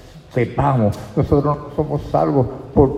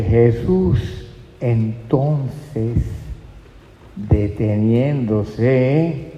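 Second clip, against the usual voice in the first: very slow, phrase by phrase; deliberate scripture reading; much slower than usual. Real, not acted. Preacher reading the verse to the congregation, slow and deliberate. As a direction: draw out each phrase of the verse with pauses.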